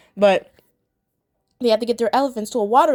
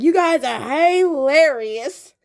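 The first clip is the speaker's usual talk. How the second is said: hyena voice; 5 semitones higher, 5 dB louder, much slower than usual. Retold or imitated. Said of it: "they're like".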